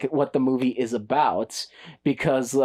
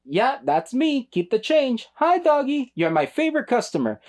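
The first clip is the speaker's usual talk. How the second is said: higher, strange caricature of Johnny; mock Johnny voice; 9 semitones higher than usual. Mocking Johnny's weird lines in the flower shop, higher and hammed-up. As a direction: ham up the odd stilted delivery. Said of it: "And it's like".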